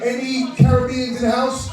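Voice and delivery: rally the Caribbeans in the room; crowd call-out. Real, not acted.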